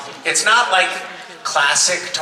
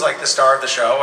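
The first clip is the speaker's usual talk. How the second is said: ordinary delivery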